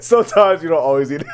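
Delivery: laughing through the words; can't get the line out for laughing. Real, not acted. Host repeating the line to Brandon while cracking up.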